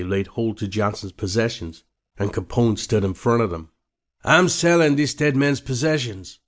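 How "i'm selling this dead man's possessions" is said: raised voice to a crowd; loud. Acted. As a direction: call it out to the crowd. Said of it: "Capone said loudly".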